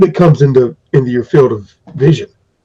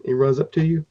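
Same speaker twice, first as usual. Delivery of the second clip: ordinary delivery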